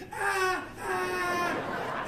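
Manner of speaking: high wailing "ah"; imitated high, loud child's wail; running child's cry. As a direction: cry out like a panicked little kid. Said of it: "He's running ahead of me like".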